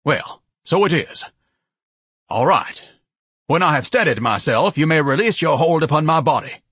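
haughty, regained dignity; pompous Ignatius. Acted. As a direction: instruct them with lofty dignity. Ignatius regains his footing.

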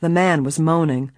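plain reading voice

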